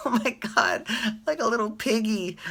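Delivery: giggling through the words; laughing. Real, not acted. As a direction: still laughing after a snort. Laughing at her own snort.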